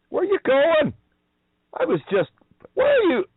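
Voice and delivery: very high, loud taunting call; mock calling after. Speaker taunting the goons who ran, very high mocking call. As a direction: call after them like they've fled.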